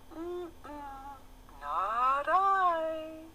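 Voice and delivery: very high squeaky rat voice. A narrator's reading. The rat turning down the hen, high and squeaky.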